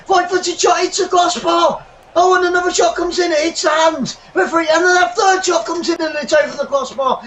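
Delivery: high, fast rising call; excited near-miss call. Real, not acted. Commentator calling a flurry of shots, excited. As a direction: gasp through the shots.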